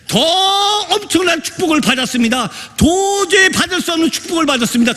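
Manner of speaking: high, sustained sermon proclamation; impassioned preaching. Real, not acted. Preacher tells congregation of salvation's miracle, impassioned preaching.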